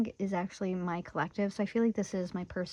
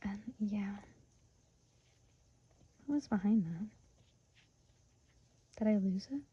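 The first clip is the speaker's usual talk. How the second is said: whisper; 4 dB quieter than usual. Real, not acted. Trailing aside.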